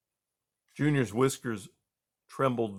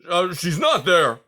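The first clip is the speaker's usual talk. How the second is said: put-on old porcupine voice; 6 semitones higher, 9 dB louder than usual. A narrator's reading. Aerith insisting, slow and loud.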